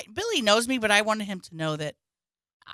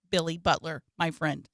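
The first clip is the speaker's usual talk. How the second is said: ordinary delivery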